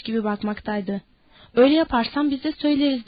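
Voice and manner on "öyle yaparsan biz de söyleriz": ordinary delivery, nothing marked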